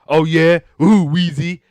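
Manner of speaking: high, loud teasing reply; hammed-up sitcom comeback; mock challenge. George answering Louise's put-down, teasingly defiant.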